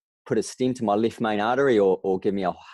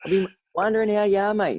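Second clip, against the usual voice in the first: put-on much higher, thrilled call to an old mate; excited friend's greeting; 13 semitones higher, 4 dB louder than usual. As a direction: greet him high and delighted, like finding treasure. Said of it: "He goes".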